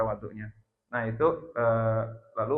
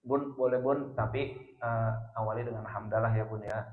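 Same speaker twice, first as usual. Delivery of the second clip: gentle coaxing; 3 semitones lower than usual. Retold or imitated. Husband asks wife to begin with praise, gently coaxing.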